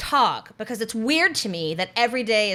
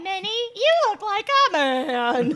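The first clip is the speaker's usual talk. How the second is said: Mickey Mouse; 10 semitones higher than usual. Acted. Recalling an old bit with a friend, bad cartoon mouse impression. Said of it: "the Mickey and Minnie Mouse voice".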